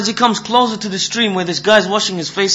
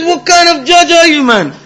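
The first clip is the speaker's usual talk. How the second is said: high, loud acted disbelief; outraged protest; 10 semitones higher, 7 dB louder than usual. Slapped man to the judge over the ruling, outraged. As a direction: challenge him, voice shooting up.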